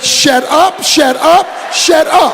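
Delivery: excited shut up. Retold or imitated. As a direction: blurt it out in happy disbelief. Excited disbelief at the offer.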